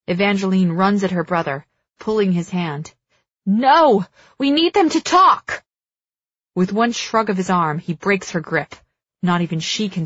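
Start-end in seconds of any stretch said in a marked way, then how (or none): 3.5-5.6 high, loud desperate protest; urgent shouting. A narrator's reading. Sister pulling at her brother's hand to stop him, urgent shout.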